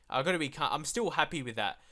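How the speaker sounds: usual speaking voice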